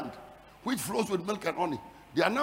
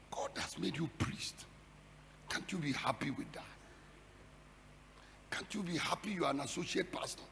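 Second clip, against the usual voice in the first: whispered, much softer reproach; soft rebuke; 4 semitones lower, 15 dB quieter than usual. Real, not acted. Preacher quietly chiding ambitious church workers. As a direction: drop to a near-whisper and chide.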